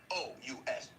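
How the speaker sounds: normal talking voice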